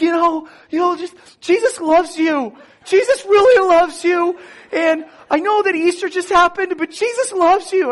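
high, loud, tearful gushing; teary drugged gushing. Retold or imitated. Drugged patient telling the anesthesiologist Jesus loves her, tearful gushing. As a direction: pour it out, dazed and overcome.

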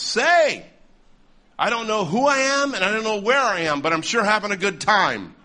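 imitating an old lady piping up, high and loud; elderly heckler. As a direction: pipe up obliviously, pitched well above your own voice. Acting out old lady interrupting his sermon.

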